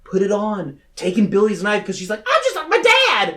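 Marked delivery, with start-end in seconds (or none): 2.2-3.4 very high, hammed-up cry; mock dramatic declaration. Heroine takes up father's knife, mock dramatic declaration. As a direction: overwrought movie line, voice pitched way up.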